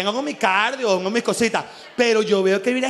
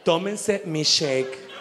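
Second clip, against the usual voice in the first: mocking influencer; 5 semitones lower, 4 dB quieter, much slower than usual. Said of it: "No diga".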